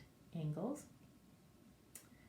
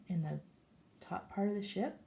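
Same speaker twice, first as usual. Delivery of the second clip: ordinary talk, nothing marked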